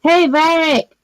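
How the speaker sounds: high-pitched call to someone overhead. Acted. Calling up to a flying companion, calling out. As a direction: hail a companion from a distance.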